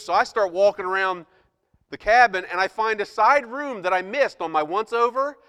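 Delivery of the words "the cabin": normal talking voice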